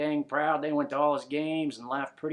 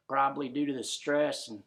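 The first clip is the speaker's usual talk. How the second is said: ordinary delivery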